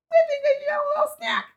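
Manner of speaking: tiny, very high squeaky voice; mock innocent craving. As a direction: squeak it quickly like a guilty little snacker. Imagined late-night snacker, acted out.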